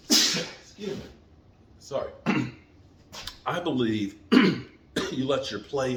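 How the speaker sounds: throat clearing, coughing fit; coughing on dust. Real, not acted. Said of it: "Got some dust in my throat".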